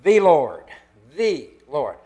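THE punched loud, pitch falling away; single out "the" with a forceful push; stress on THE. Shown as a demonstration. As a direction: lean hard on "the" before Lord. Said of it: "You could emphasize every word of it".

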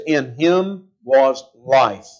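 slow, weighty "In him was life"; slow emphatic reading. Shown as a demonstration. Preacher urging the congregation to focus, slow stress. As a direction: lean on each word slowly. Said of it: "I want you to focus on that".

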